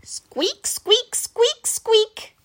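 high squeaky squeak sounds; squeaky rubber duck. Acted. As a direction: squeak like a toy. Reading squeak sound effect.

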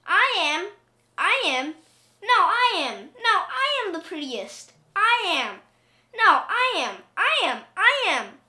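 high, loud competing cries; clamouring cats. A narrator's reading. Vain cats quarrel over who is prettiest, loud high cries. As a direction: insist on it, each cry punched high. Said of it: "cried hundreds and thousands and millions".

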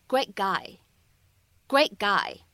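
"great guy" slowly, the t of "great" unreleased; lost plosive, slow. Shown as a demonstration. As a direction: swallow the t before "guy", slowly. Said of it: "失去爆破的处理". ("great guy"的t失去爆破)

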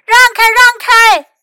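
high, urgent shout to clear the way; loud warning yell, pitched high. A narrator's reading. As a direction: shout people out of your path. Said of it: "他大喊着".

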